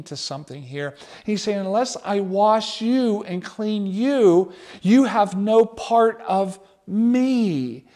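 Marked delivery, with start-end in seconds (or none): 1.6-7.9 very slow, weighty words; slow solemn. Acted. Jesus answering Peter, slow and solemn. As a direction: lay each word down slowly and gravely.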